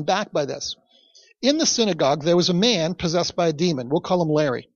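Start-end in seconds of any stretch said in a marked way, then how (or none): none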